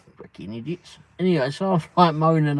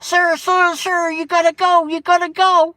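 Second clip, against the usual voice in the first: high, loud, frantic calls of sir; imitating a panicked worker telling him to leave; urgent staff voice; 12 semitones higher, 8 dB louder than usual. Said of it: "opens up the side door and went".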